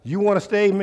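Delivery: ordinary talk, nothing marked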